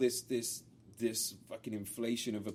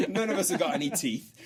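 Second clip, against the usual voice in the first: laughing; 6 semitones higher, 3 dB louder than usual. Real, not acted. Self-mocking joke.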